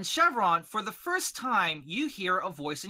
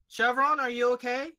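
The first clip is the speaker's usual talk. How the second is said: gentle check-in; 7 semitones higher, 4 dB louder than usual. Acted. Lily asks the dog if he is okay, high and gentle. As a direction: ask kindly, voice lifted.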